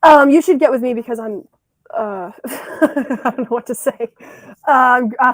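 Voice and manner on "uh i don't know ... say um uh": giggling through the words; laugh, lost for words; nervous laughing. Real, not acted. Flustered on the spot date.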